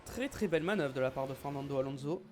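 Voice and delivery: excited race commentary, loud bursts; motorsport commentator. Acted. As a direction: call the overtake like a TV commentator. Celebrates a rival's overtake. (commentateur sportif enthousiaste)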